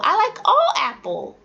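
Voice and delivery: high, eager mistaken answer; student's wrong plural; imitating a student. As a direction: play the eager kid still wrong. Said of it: "They'd be like".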